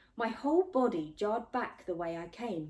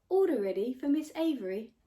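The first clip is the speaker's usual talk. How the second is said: higher, louder announcement with a rising end; digital assistant; 6 semitones higher, 7 dB louder, faster than usual. A narrator's reading. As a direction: call the order like a cheerful machine. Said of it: "called Somi from the meal dispenser".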